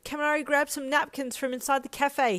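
loud, high urgent command; ordering. A narrator's reading. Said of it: "he ordered".

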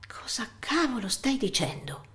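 a sharp, disbelieving whisper; shocked whisper. Acted. Son to his mother during their quarrel, in an incredulous whisper. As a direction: hiss it under the breath, stunned. Tense argument over mother's lover.

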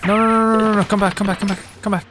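frantic pleading; panicking gamer. Real, not acted. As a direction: beg it not to run away. Pokemon fleeing, begging it back.

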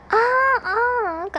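loud high excited cry. Real, not acted. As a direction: squeal with excitement. Excited recognition.

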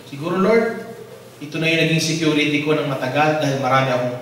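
normal talking voice

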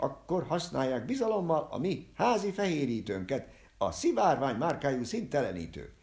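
high, loud market-crier pitch; cried out sales pitch. Acted. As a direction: call out the product like a fairground seller. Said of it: "felkiáltott". (magas, hangos vásári kikiáltás)

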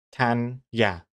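Tanya broken into TAN, ya; two-syllable Tanya. Shown as a demonstration. Streamer arguing it's two, Tanya in two parts. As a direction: split Tanya into two separate beats, tan-ya. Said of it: "it's two".

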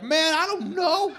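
very high panicked squeal; freaking out. Pretend. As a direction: panic like the app is lost. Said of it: "Just freaking out".

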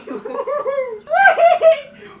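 a shrieking burst of laughter. Real, not acted. Laughing at own choice.

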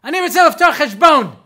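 sudden high, very loud bellow; full-volume comic outburst; screaming. Retold or imitated. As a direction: scream the request at the clerk. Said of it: "So I started screaming".

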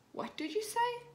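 high indignant demand; cross. A narrator's reading. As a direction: challenge him, offended. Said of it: "says Mummy Pig crossly".

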